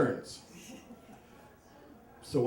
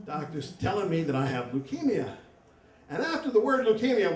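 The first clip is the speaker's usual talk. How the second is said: ordinary talk, nothing marked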